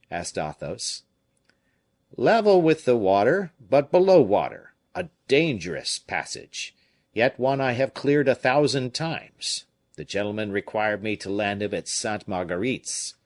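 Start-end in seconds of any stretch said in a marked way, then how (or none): 2.1-13.1 fisherman's voice. Acted. Fisherman telling the comte about the boat, raised and louder.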